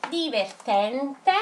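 slowly drawn out; slow dictation. Shown as a demonstration. Teacher dictating to the class, slowly. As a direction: spell it out with the voice. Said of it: "non andate di fretta".

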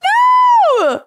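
high, drawn-out theatrical "no"; outraged cry. Pretend. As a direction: shout it in mock horror. Commentator reacts in outrage.

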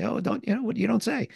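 high, delighted you don't say; mock surprise. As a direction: translate the surprise in the same high voice.

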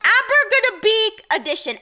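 excited announcement. Real, not acted. Announcing the game title.

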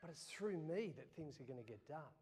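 God answering low and soft. Retold or imitated. God gently correcting a doubting pastor, low and soft. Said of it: "And he says".

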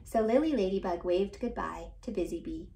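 ordinary delivery, nothing marked

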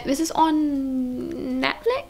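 unsure question. Real, not acted. Telling viewers where to stream it, unsure question. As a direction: ask "This is on Netflix?" as if checking. Unsure, checks herself, then restates.

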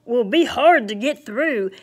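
lightly voiced Santa calling out. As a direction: call it out like Santa to his reindeer. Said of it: "he called out".

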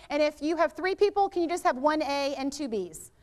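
usual speaking voice

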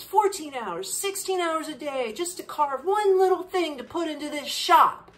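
loud, high, scolding tirade; angry shopkeeper rant. Retold or imitated. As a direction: give the tourist a furious telling-off. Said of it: "she went off on me".

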